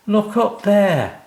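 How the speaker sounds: put-on a cheery old workman breezing in; kindly odd job man. O'Grady finds the crying family, cheerful and high-pitched. O'Grady reveals tree house.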